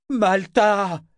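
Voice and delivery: high, drawn-out bellowed name; loud, desperate call; drunken bellow. A narrator's reading. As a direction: bellow her name into the night. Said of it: "beugla-t-il dans la nuit".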